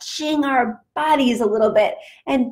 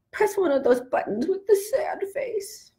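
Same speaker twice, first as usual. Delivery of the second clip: mock sad; 7 semitones higher, 5 dB quieter, faster than usual. Shown as a demonstration. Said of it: "sad today?".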